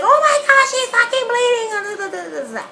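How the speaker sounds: mock screaming relatives. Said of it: "everybody starts screaming".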